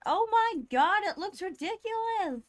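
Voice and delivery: high, loud exclamation of disbelief; amused disbelief. Real, not acted. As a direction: exclaim at a ridiculous sight, voice shooting up. Reacting to a ridiculous-looking picture.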